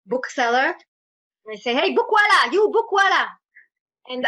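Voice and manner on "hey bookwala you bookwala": put-on loud, high Israeli-accented haggling call. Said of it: "an American accent ... I sound very, very Israeli".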